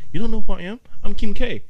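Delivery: high, loud diva outrage; indignant celebrity. Retold or imitated. Kim K confronting the bouncer, high indignant diva. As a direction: play the offended star.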